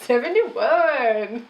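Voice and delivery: drawn out. Pretend. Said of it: "That's not a thing".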